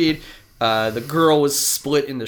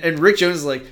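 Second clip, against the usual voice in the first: usual speaking voice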